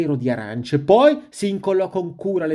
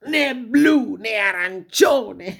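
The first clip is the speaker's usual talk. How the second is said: high, drawn-out exasperated complaint; exhausted protest; 6 semitones higher, slower than usual. A narrator's reading. Worn-out wolf at the mirror, exasperated protest. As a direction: list each refusal slowly, fed up, then declare it.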